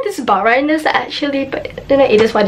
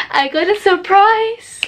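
rising, drawn-out excited announcement; excited reveal; 10 semitones higher than usual. Real, not acted. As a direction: announce it slowly, pitch climbing high. Revealing her surprise, first take.

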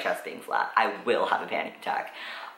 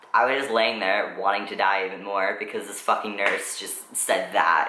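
ordinary delivery

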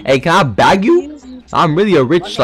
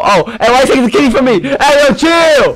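high, rushed complaint climbing higher; agitated protest; 8 semitones higher than usual. Pretend. Player losing the kitty to someone, high agitated protest. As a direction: object urgently, pitch rising.

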